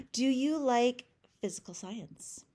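usual speaking voice

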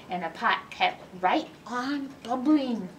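usual speaking voice

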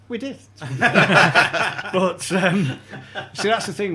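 can't stop laughing while telling it. Real, not acted. Recalling meeting Tony Evans, laughing loudly.

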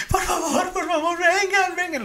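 high, drawn-out begging; desperate pleading cry. Retold or imitated. Caller in the car begging police to come, high. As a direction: imitate a woman begging for help to come. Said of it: "así gritando".

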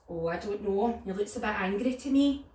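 usual speaking voice

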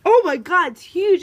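loud, high startled yell; shocked screaming. Real, not acted. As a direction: shout it out in alarm. Finding something huge, shocked.

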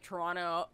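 TORONTO run together as "Toronno"; Toronto without second T. Shown as a demonstration. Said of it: "I don't wanna say it's, like".

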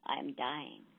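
low faint voice of a dying buffalo. A narrator's reading. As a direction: let the words sink low, near death.

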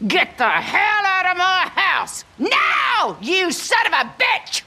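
outraged screaming order; furious shout. Acted. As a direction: scream him out of the house. Woman throwing man out.

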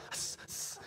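soft, voiceless "tss" noises; two quiet sibilant bursts; imitated cicada hiss. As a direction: mimic the mystery hiss. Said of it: "we hear this tss".

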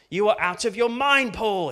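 loud, high outburst; shouted. Retold or imitated. Festus shouting at Paul mid-defense, loud and high. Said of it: "he shouted".

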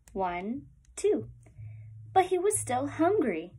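lively storybook read-aloud with rising hungry refrains; reading to children. Acted. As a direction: read it like story time for little ones. Reads picture book to class.

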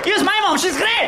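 high, loud mock complaint; whiny guest. Comedian mocking himself as a rude dinner guest, high and loud.

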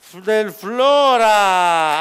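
drawn-out proclamation of the winner; showy game show host. Acted. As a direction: stretch out the winner's name slowly. (conduttore da quiz, proclama il vincitore lentamente)

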